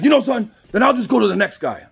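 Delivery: high-pitched huffy interruption; acting out a fed-up customer cutting in; retold indignant outburst; irritated customer. Customer cuts in, then storms out.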